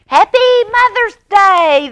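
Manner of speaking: a loud, high joyful shout. Acted. Children waking Mama with a cheerful shout. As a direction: burst out with the greeting. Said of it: "they shouted".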